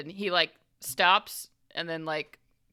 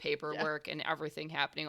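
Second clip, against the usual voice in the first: ordinary talk, nothing marked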